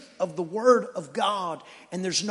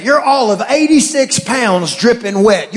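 normal talking voice

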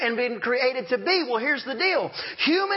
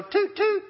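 much higher, light toot-toot; friendly toot; 10 semitones higher than usual. Retold or imitated. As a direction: tap it out like a friendly little horn. Friendly driver tapping the horn.

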